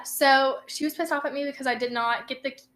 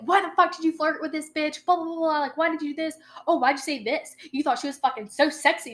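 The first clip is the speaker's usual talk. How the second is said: mild mock anger; 4 semitones higher than usual. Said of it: "she was fuming pissed off at me".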